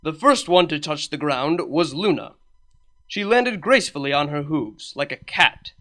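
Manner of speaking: usual speaking voice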